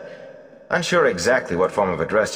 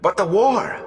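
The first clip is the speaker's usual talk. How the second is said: high, eager interruption; 4 dB louder than usual. Acted. Mat eagerly asks the Gleeman about war. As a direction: burst in keen to know. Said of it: "Mat began eagerly".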